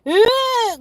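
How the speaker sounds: a short, high, loud mock wail, imitating her daughter; imitated crying wail. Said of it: "she starts crying, like".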